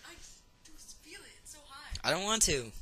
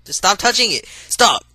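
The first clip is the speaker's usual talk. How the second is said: panicked pleading; 6 semitones higher, 8 dB louder, slower than usual. Real, not acted. Phone sparking, begging stop.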